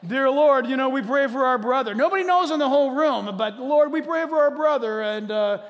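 loud, high, hammed-up prayer voice; mock pious prayer. Preacher mocks a churchgoer gossiping in prayer, loud and hammed-up.

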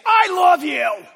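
loud, slow, harsh I love you; wrong tone. Shown as a demonstration. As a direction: say "I love you" loud and cold so it warms no one. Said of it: "the tone of your voice".

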